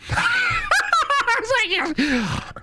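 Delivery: high shrieking laugh. Real, not acted.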